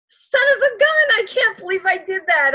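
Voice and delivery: high, loud son of a gun; exasperated exclamation. Retold or imitated. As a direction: kick yourself out loud. Self-quote of frustration.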